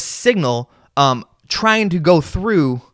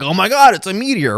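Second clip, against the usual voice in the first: mock amazement; 5 semitones higher than usual. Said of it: "and they're like".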